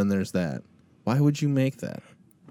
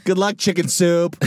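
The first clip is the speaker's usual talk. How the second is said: schoolkid taunt; 7 semitones higher, 4 dB louder than usual. Pretend.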